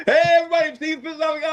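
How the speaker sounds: high-pitched laughing tease; laughing through the teasing. Real, not acted. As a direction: cackle while mocking his friend's temper.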